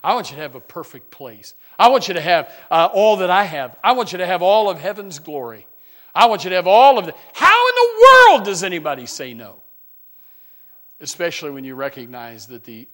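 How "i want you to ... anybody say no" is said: loud, rising preacher's cry; full-force preaching. Acted. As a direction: preach it out to the back row. Preacher building to a climax.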